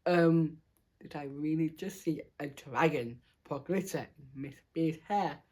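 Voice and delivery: soft, hushed aside, still voiced; half-whispered, hesitant question. A narrator's reading. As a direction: lean in and ask it under your breath. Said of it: "Jason leaned over the, the table and whispered".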